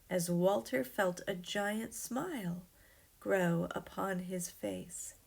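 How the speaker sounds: plain reading voice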